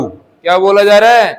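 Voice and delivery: ordinary talk, nothing marked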